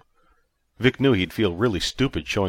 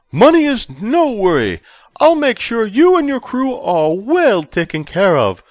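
high, loud, overjoyed official; giddy chancellor; 8 dB louder than usual. A narrator's reading. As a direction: burst out with delight like a giddy schoolboy. Verbidi dismisses money.

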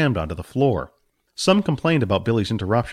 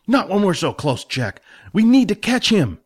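put-on determined young hero; 9 semitones higher than usual. Billy insists.

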